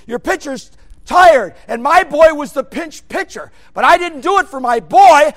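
loud, high full-force sermon shouting; fiery preaching. Acted. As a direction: thunder it from the pulpit.

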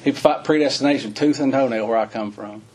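normal talking voice

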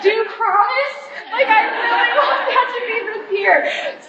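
high, slow, laughing hope; laughing plea. Real, not acted. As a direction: beg through a laugh.

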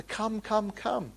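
pleading call. Real, not acted. Preacher urging sinners to come.

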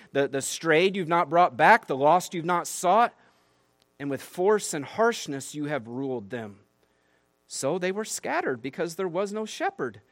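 reading God's rebuke with rising force, high and loud; fervent scripture reading. Acted. Pastor reads Ezekiel's rebuke to the congregation, preaching with force. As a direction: proclaim it like a prophet thundering at the shepherds.

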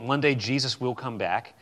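ordinary talk

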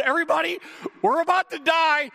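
high, loud mock pilot alarm; hammed-up panicked captain; alarmed announcement. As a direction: announce doom to the whole cabin. Pilot announcing doom, retold again.

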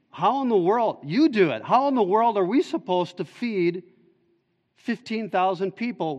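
exasperated disbelief. Retold or imitated. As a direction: throw up your hands at an impossible task. Disciples voiced in exasperation.

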